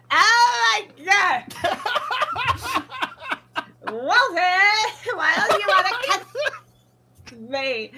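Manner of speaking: loud, slow, laughing, cracking wail; unhinged girl voice. Retold or imitated. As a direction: scream it out half-crying. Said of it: "it became more unhinged".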